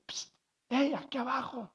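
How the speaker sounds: furtive little frog calling up; mysterious voice from below. Acted. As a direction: get her attention from down below. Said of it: "oye una voz".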